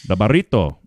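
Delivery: "burrito" with a rolled R, Spanish vowels; Spanish "burrito" with trilled R; Spanish pronunciation. Shown as a demonstration. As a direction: say "burrito" the Spanish way, bah-REE-toh. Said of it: "That's how you say it".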